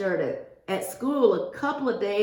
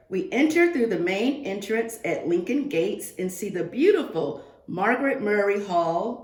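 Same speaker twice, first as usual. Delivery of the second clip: ordinary delivery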